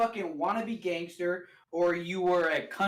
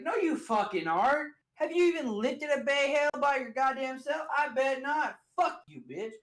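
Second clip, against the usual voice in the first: higher-pitched mock tirade; mock indignant retort; 7 semitones higher than usual. Challenging fake country boys from high school, mock indignant. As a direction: tear into a poser, half in jest.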